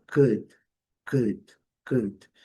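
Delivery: could, said short and quick with a clipped vowel. Shown as a demonstration. Teacher modeling a short could for students. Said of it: "a pronúncia é bem simples, bem curta, bem rápida".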